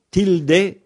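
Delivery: "tilde" said the Spanish way, a rising tilDE; "tilde" with a Spanish e at the end. Shown as a demonstration. Teacher to listeners, giving the Spanish "tilde". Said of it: "en espagnol".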